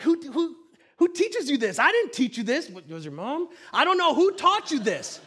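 high-pitched, mock-baffled parent; exasperated disbelief. Father questioning his rebellious two-year-old, exasperated. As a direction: demand answers in exaggerated bewilderment.

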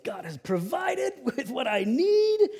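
high, loud, drawn-out mock rejoicing; hammed-up slow, soaring exultation; bubbling over. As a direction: imitate a friend overjoyed by a miracle. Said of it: "just bubbling".

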